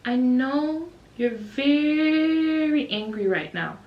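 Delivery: slow, careful preschool-teacher coaxing; calm teacher. Retold or imitated. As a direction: talk a small child down gently and patiently. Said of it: "me and my co-teacher are just so calm".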